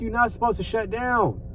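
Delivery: ordinary delivery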